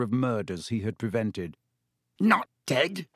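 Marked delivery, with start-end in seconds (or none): 2.2-3.1 choked. A narrator's reading. Caretaker hearing his cat lives, choked. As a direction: catch the words in your throat. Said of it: "choked Filch".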